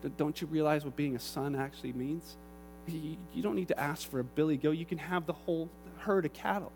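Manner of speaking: father gently answering his resentful son; tender father. Acted. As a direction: reassure him quietly.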